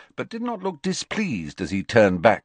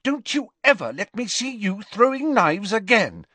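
high-pitched, forceful scolding; furious; 4 dB louder than usual. A narrator's reading. Mother scolding her son for throwing knives, furious. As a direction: scold hard, voice pushed way up. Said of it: "she said furiously".

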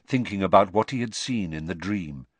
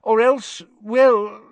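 embarrassed; 14 semitones higher, 7 dB louder, slower than usual. A narrator's reading. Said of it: "Fudge sounded embarrassed".

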